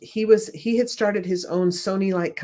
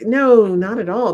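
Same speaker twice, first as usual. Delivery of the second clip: louder and higher emphatic denial; 4 semitones higher, 7 dB louder than usual. Retold or imitated. Mother answering her son, emphatic and loud. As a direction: shoot the question down firmly.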